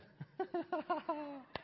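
high-pitched rolling chuckle; laugh. Real, not acted. Preacher laughing after asking for an amen, amused. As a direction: laugh in a high run of ha-has.

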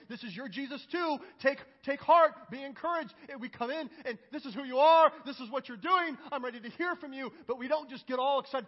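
high, loud, driving sermon delivery; fervent preaching. Acted. Preacher exalting the King of Kings to church, fervently. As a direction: build in praise, punching key words loudly.

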